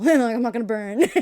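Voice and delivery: bursting into loud laughter mid-joke; laughing. Real, not acted. Joking with the interviewer about hell, loud laughter.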